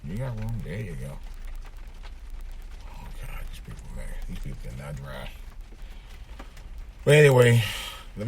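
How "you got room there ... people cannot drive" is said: much quieter and lower, talking to himself; low, soft off-hand driving commentary; muttered aside. Real, not acted. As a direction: grumble under your breath at other drivers. Aside to other drivers while recording.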